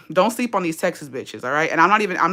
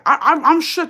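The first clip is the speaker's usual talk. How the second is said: mock shock; 10 semitones higher, 7 dB louder than usual. Real, not acted. Sarcastic jab at rival.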